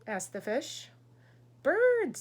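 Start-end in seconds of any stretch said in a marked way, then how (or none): 1.6-2.2 high, loud dramatic reveal; mysterious. Acted. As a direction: announce it like a big secret. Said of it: "said the frog mysteriously".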